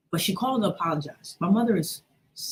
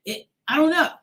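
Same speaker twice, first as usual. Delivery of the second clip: ordinary delivery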